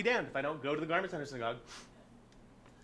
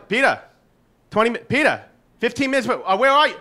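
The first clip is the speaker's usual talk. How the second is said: high, loud urgent calling; 9 semitones higher, 11 dB louder than usual. Retold or imitated. Group chat calling for Peter, high and loud. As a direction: call him up anxiously.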